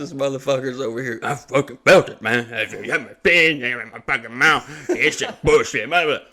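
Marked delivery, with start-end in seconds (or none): 1.2-6.2 louder mumbling complaint through a numb mouth; imitating a doped-up friend griping; slurred angry rant. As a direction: gripe like your jaw is numb.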